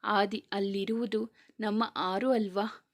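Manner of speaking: ordinary delivery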